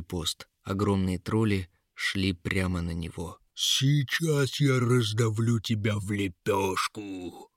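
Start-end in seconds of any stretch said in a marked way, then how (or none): 3.5-7.5 slow, drawn-out monster voice; gloating swamp troll. A narrator's reading. Swamp troll to wounded guard underfoot, slow gloating creature voice. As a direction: gloat like a lumbering troll, stretching the words. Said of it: "захохотал болотный тролль".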